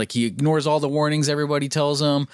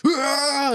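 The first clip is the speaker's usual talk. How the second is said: scared scream; 13 semitones higher than usual. Retold or imitated. Imitating scared campers.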